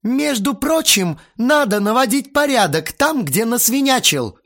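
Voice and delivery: loud, very high scolding; hysterical shout. A narrator's reading. Aunt screams from the room about mess, hysterical. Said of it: "истеричным голосом закричала из комнаты".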